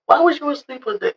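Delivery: high questioning mom voice; imitating mom. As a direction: lightly play the puzzled mother. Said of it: "rendition of how the phone call went".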